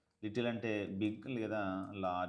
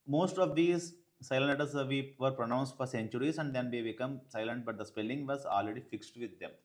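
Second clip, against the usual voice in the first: textbook read-aloud; 3 semitones higher, 4 dB louder, much slower than usual. Acted. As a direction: read it to the class slowly and clearly. (గట్టిగా నెమ్మదిగా పాఠం చదవడం)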